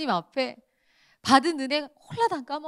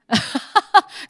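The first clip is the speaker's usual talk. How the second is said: 웃음; 10 semitones higher, 14 dB louder than usual. Real, not acted.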